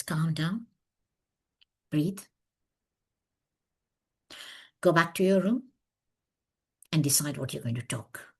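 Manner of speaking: calming. Retold or imitated. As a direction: soothe a panicking colleague. Kind director calms her.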